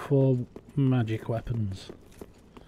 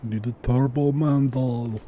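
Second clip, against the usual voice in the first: slow, drawn-out bad action-star impression; badly imitated movie star, slow delivery; terrible Arnie; slower than usual. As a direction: do a bad action-hero impression, stretching the words. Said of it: "that was my terrible Arnie impression".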